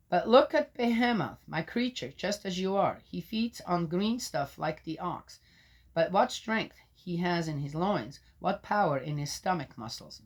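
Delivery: solemn public reading of Job; scripture reading. Acted. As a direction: proclaim it like a lector. Reads scripture.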